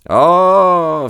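long, high "Ah"; intrigued exclamation. Acted. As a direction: draw it out, pitch arching up. Holmes reacts to revelation. (exclamation intriguée, aiguë et traînante)

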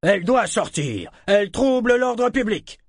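high, forceful official outcry; loud accusation. A narrator's reading. Guard demanding Althea leave the hall, loudly. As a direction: raise pitch and volume to expel her.

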